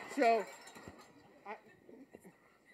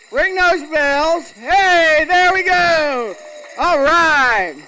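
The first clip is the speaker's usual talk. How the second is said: slow, loud cheer to ring the bells; a joyful, drawn-out whoop of triumph; excited; 4 semitones higher, 5 dB louder, much slower than usual. Real, not acted. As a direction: celebrate the goal, booming. Celebrating reaching the goal.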